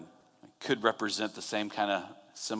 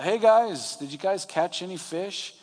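high-pitched call across the water; retold yell to a distant boat; 7 semitones higher than usual. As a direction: holler a friendly question from far away. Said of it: "someone yells out".

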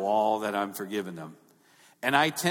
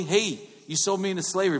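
higher-pitched pretend reproach; mock accusation; 7 semitones higher, 3 dB louder than usual. Shown as a demonstration. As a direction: call them out, as if accusing. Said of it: "it may sound slightly".

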